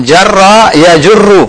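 slow, careful "jarra ya jurru"; teacher dictates the verb slowly to the caller; slow dictation. Shown as a demonstration. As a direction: say "jarra ya jurru" slowly, each syllable clear. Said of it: "Satu lagi".

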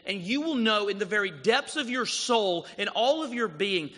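full-voiced preached invitation from Jesus; fervent invitation. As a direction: lift them up with a passionate promise.

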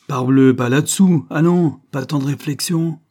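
loud, high, exasperated retort; impatient scolding. A narrator's reading. Banquiste answers the hesitant child, impatient scolding. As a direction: snap back with rough impatience.

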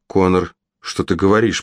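ordinary delivery

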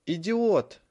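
loud furious scream; yelling. A narrator's reading. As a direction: roar the insult. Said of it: "заорал Драко".

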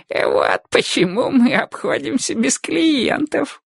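put-on high, slow old man's voice; tittering old man, high and slow; old prospector. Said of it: "хихикнул старик".

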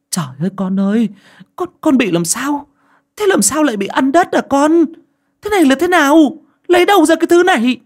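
high, loud, building panic; frantic mother. A narrator's reading. Mother shaking her daughter, shouting in panic, very high. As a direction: shake the child and shout. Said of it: "cầm vai tôi lắc mạnh".

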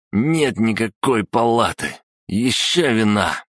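put-on high, slow drunken yell; drunken shouting. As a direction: holler it out, sloppy drunk. Пьяный Саша кричит. (пьяный крик)

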